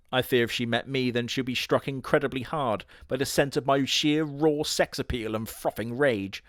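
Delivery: ordinary talk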